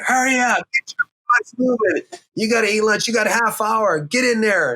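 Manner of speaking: high, hammed-up herding call; bossy wrangler. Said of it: "didn't have a whip in her hand".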